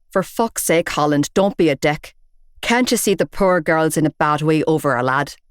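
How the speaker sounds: a hint of a gruff Belfast man; Belfast accent. Big bearded man telling off Shane, in a Belfast accent. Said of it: "distinctive Belfast accent".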